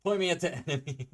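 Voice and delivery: fast, high, loud, laughing; giddy hammed-up war cry; mock battle cry. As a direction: yell it gung-ho, cracking up. Acted battle cry, breaking into laughter.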